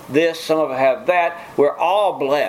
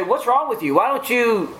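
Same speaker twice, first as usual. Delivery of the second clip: mock reproach; 9 semitones higher than usual. Acting out worldly people challenging believer.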